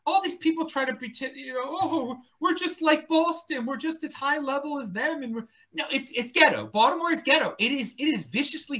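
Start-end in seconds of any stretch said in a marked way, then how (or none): 1.7-5.2 mock pretentious. Mocking Baltimore pretenders.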